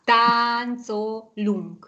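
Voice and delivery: "Táncolunk" broken into long syllables; teacher's drawn-out syllable-by-syllable "Táncolunk"; very slow syllables. Shown as a demonstration. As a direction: sound out "Táncolunk" very slowly. Teacher sounds out spelled word.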